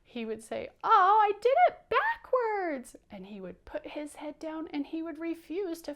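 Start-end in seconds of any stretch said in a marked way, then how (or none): 0.8-3.0 very high, loud puppet wail; upset wail. Pretend. Powder wrote a letter D by mistake, upset wail. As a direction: wail it, hugely upset over a tiny mistake. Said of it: "That's how upset he gets".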